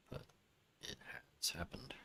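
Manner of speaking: hushed whisper. Acted. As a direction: breathe it out softly. Whispered aside.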